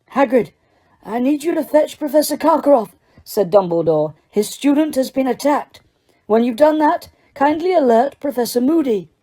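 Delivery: a hint of an old headmaster giving orders; calm, urgent instructions, lightly voiced; lightly voiced Dumbledore. Dumbledore sending Hagrid for help, lightly voiced urgent orders.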